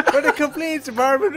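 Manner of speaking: high, loud mock Irish dissolving in laughter; helpless giggles swallowing the words; failing not to laugh. Real, not acted. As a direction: squeal it through real laughter. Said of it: "do this probably without laughing".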